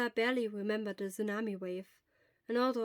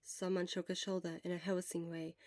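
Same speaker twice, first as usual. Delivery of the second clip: ordinary delivery, nothing marked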